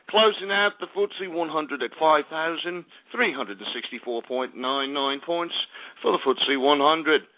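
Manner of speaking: ordinary talk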